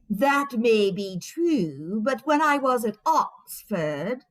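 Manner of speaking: slow, drawn-out mock British accent, pompous; mocking fake British accent. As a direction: parody his put-on British accent, very slowly. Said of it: "your fake British accent".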